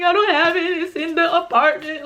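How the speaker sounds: high-pitched weepy reply; mock tearful whine. Telling her friend the medicine's elsewhere, tearful. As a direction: whine it as if crying. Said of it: "Activate the tears".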